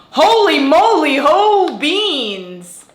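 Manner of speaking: high, loud delighted exclamation; amazed. Real, not acted. Vlogger opening the coffee bag, loud and amazed. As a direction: marvel at the beans, stretching them out slowly.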